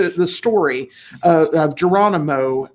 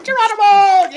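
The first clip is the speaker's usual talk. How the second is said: high playful shout of Geronimo; screamed Geronimo; 6 dB louder than usual. Retold or imitated. Recalling childhood games, a playful high Geronimo yell. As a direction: yell it like a kid jumping off something. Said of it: "you scream Geronimo".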